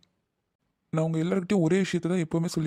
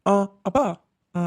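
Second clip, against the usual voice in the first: ordinary talk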